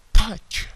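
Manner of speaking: Touch with an exploding initial t; plosive t in touch. Shown as a demonstration. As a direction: punch the t of Touch out loud. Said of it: "T itu plosif, meledak". (touch dengan t plosif meledak)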